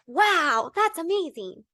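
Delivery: mildly hammed-up delighted wonder; amazed self-quote. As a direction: quote yourself being impressed. Quotes own amazed reaction.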